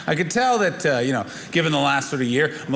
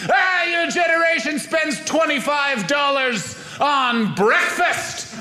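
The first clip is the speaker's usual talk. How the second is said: high-pitched, exasperated elder imitation; griping older relative; 8 semitones higher than usual. Older family member scolding a millennial's wasteful spending, high exasperated griping. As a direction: groan and scold like a disgusted old relative. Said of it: "various older members of my family walking up going".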